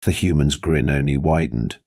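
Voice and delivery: plain reading voice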